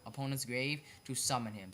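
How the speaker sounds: ordinary talk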